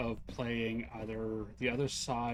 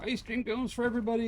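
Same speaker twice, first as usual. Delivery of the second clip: mock giveaway cheer; 10 semitones higher, 4 dB louder, faster than usual.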